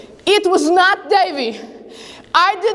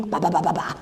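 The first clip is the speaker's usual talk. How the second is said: mock sheep bleats; 10 semitones lower, 7 dB quieter, faster than usual. Sheep eating in the field.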